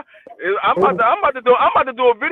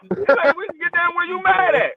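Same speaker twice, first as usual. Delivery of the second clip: mock challenge; 6 semitones higher than usual. Acted angry reply to the whisper.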